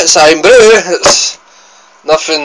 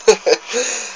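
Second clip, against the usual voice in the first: short laugh; 8 semitones higher, 4 dB quieter than usual. Real, not acted. Laughs after the impression.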